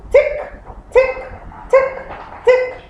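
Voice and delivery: high, light tick tick; ticking clock sound. Shown as a demonstration. Teacher showing pupils a clock's sound, high playful ticking. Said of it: "that's the sound a clock makes".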